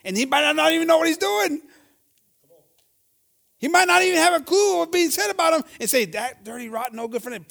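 high, loud preaching rising to a shout; impassioned preaching. Acted. Preacher warning church about gossip, rising to a shout. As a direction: build it to a shouting climax.

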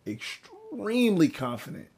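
"extremely" drawn out on a high swoop; emphatic EXTREMELY. Real, not acted.

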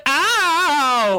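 long, high "ow" sliding down; drawn-out cartoon cry of pain; mock pained yelp. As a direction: imitate a high, wailing "ow". Mimics Kitty hitting a branch.

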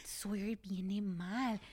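whispered slowly. Retold or imitated. Mom retelling her whispered warning to husband, slow whisper.

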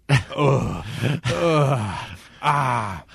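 loud drawn-out mock moans; mock moaning. Storyteller imitating the noisy basement couple, mock moaning. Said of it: "it is the sound of moaning".

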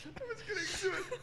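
high-pitched laughter through words; cracking up while talking; laughing. Real, not acted. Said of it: "I just got really lightheaded laughing just then".

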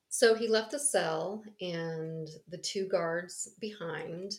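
usual speaking voice

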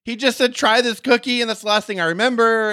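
very high, dazed-woman act; Elizabeth. Acted. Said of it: "And she's like".

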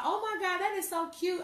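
high, fake sweet praise; mock sugary compliment; fake gush. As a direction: coo it like a phony friend. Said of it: "Talking about".

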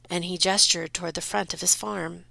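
ordinary delivery

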